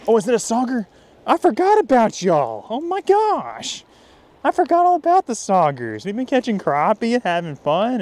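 high-pitched, stretched-out amazed hollering; thrilled surprise. Real, not acted. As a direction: cry out in delighted disbelief at the catch. Angler surprised by a forgotten species.

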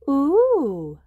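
put-on an awed ooh from the children; gasping ooh. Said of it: "gasp Peppa and George".

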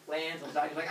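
ordinary delivery

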